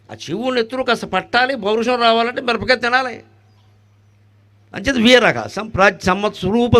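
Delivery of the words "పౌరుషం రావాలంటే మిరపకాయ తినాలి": ordinary delivery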